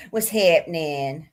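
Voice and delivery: low, slow, stretched greeting with a rising end; playful drawn-out greeting. Pretend. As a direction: drag it out low and goofy. Playful repeated greeting, varied take.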